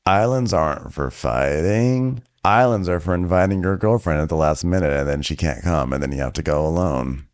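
low, slow mock sincerity; mocking Craig. Craig forgiving Shep on camera, low slow mock sincerity. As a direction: drawl it low and earnest.

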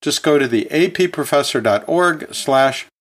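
ordinary talk, nothing marked

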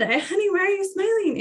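asking in amused disbelief; baffled. Retold or imitated. Mother asking her smiling daughter why, high amused disbelief.

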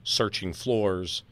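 ordinary delivery

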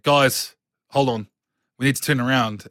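lightly acted warning to friends; player alerting party. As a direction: call a halt to your companions.

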